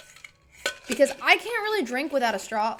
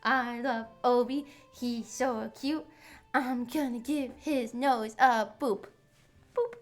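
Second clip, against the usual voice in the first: slow, lightly sing-song pet talk; cooing at the cat; 2 semitones higher, slower than usual. Real, not acted.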